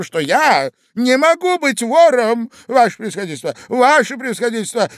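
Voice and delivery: Иван Андреевич. A narrator's reading. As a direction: appeal loudly in desperation. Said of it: "кричал Иван Андреевич". (отчаянный возглас)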